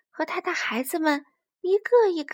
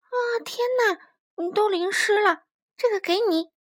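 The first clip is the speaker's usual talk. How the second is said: higher-pitched character voice of a little hedgehog; high-voiced hedgehog, surprised and concerned; 8 semitones higher, 3 dB louder than usual. A narrator's reading. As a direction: exclaim in a high little animal voice, then offer help. Hedgehog finds friend soaked.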